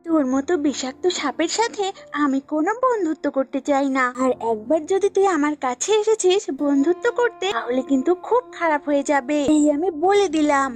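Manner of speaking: lightly voiced goat, warning off; threatening goat. Goat warns snake off friendship, mildly threatening. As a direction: reject the offer with mild menace.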